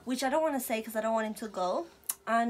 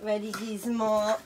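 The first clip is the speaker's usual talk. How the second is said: ordinary talk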